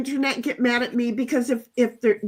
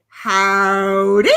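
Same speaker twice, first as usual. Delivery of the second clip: slow cowboy howdy; 3 semitones lower, 6 dB louder than usual. Acted. Reading a chat greeting in character.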